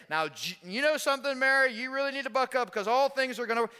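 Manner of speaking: high, loud scolding lecture; mock a preachy lecturer telling her to buck up; lecturing tone. Jesus as he did not speak to grieving Mary, mock high lecture. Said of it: "he doesn't lecture her".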